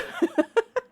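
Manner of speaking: high giggle. Real, not acted.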